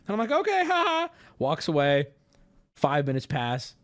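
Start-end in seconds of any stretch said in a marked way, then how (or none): 0.3-1.1 high, forced little laugh; imitated nervous politeness. Driver placating a gate officer, mock nervous. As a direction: pitch it up, awkwardly agreeable.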